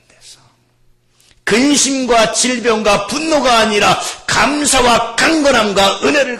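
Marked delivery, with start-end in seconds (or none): 1.5-6.4 loud, emphatic proclamation; full-force preaching. Real, not acted. Preacher urging gratitude over anger, forceful proclamation. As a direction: punch out each contrasting noun.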